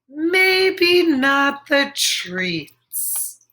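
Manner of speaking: very slow, drawn-out hesitation; not sharing treats, very slowly; reluctant. Acted. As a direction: stretch it out, unwilling to share.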